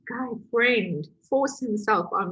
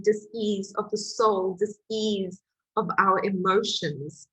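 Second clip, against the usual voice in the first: ordinary talk, nothing marked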